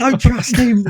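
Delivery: high, loud, pleading warning; desperate shout. Retold or imitated. Ghost yelling to his teammates, high and loud warning. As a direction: yell a warning nobody can hear. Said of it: "you're sitting there like".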